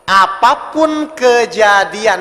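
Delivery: very slow, every syllable stretched out; drawn-out, deliberate teaching emphasis; drilling it in. Real, not acted. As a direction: spell it out slowly for the listeners to remember. Drilling key point into audience.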